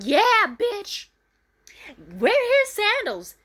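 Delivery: high, loud, mocking yell; shrieking taunt at the departing girl. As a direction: yell it like a jeering rival. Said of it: "The bitch yelled out".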